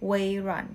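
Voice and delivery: "เว่ยหรัน" said as Mandarin wei-ruan; "เว่ยหรัน" in Chinese tones; Mandarin reading. Shown as a demonstration. Teacher giving the Chinese name for Microsoft, Mandarin tones. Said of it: "เขาอ่านว่า".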